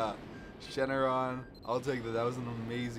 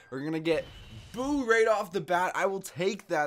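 higher, louder rush of delight; excited; 6 semitones higher, 6 dB louder than usual. Real, not acted. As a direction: call out the pull, thrilled. Pulls Boo card.

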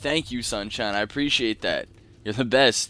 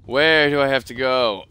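louder exasperated question; fed up with being lost; frustrated; 3 semitones higher, 8 dB louder than usual. Real, not acted. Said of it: "I am getting so angry".

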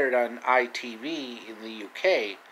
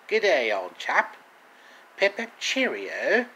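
mock posh British greeting, lightly done; a hint of British, slowing on the cheerio; British accent; 3 semitones higher than usual. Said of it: "perfect British accent".

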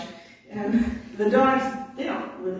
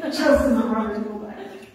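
ordinary delivery

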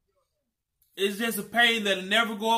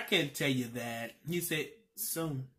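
soft, low answer; 8 semitones lower, 10 dB quieter than usual. Retold or imitated.

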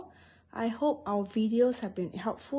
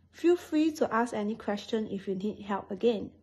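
ordinary talk, nothing marked

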